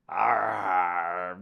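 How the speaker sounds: low, loud playful growl; bear growl. Pretend. Reader acting out the bear for children, loud low growl.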